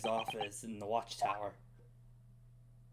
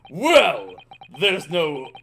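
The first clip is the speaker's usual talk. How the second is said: loud, dismissive governor; Armstrong voice; 10 dB louder than usual. Acted. Governor turning the Japanese lawyer away, loud scorn. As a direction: brush the foreigner off with contempt. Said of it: "my best Armstrong voice for him".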